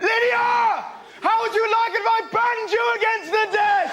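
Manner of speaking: high, loud mock teacher shout; furious teacher. Teacher snapping at girl banging her calculator, furious shout. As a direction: explode at the pupil, pitched right up. Acted teacher losing temper at pupil.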